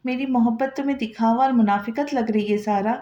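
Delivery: ordinary delivery, nothing marked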